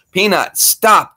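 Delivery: high, loud, slow call of stop; drawn-out telling-off of the dog; scolding the dog. Real, not acted. Telling the whining dog Peanut to stop, loud and high.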